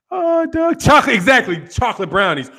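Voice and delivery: ordinary talk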